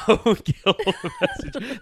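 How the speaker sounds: high, slow, laughing through the catchphrase; laughing repeat. Pretend. Friend echoes the catchphrase, laughing high and slow. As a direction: savor it while cracking up.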